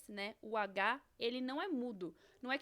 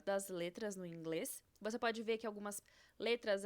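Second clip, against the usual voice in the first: normal talking voice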